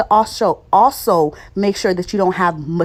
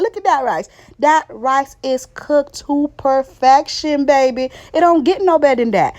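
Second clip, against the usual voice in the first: proud excitement; 6 semitones higher than usual. Real, not acted. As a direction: show off the rice like a proud cook. Showing off the cooked rice.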